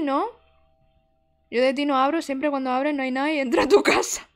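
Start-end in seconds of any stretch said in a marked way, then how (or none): 1.5-4.3 1 loud warning. Real, not acted. Loud warning not to open.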